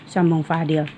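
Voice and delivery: ordinary delivery, nothing marked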